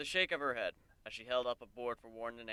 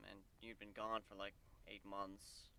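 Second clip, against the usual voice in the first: softer and slow, fading into embarrassment; trailing off awkwardly; 3 semitones lower, 10 dB quieter than usual. Acted. Warren confessing to Kuno, trailing off softly and slowly. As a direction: let the excuse die away sheepishly. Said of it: "Warren trailed off awkwardly".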